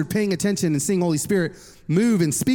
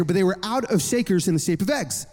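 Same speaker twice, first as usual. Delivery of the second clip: ordinary talk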